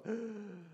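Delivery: soft awestruck intake of breath; Smeagol's gasp. Acted. Smeagol hears his real name, soft gasp. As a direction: gasp quietly as if struck. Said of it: "Smeagol goes".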